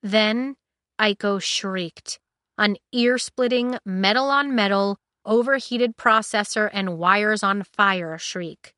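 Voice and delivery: plain reading voice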